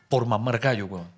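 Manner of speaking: ordinary talk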